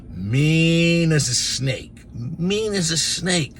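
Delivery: mean as a snake, dragged out slowly; slow emphatic repeat. Retold or imitated. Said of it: "describing the nun".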